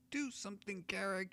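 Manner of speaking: high, pleading halfling voice; halfling begging Garrick for help in a high voice; halfling crying out. Acted. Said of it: "he cries".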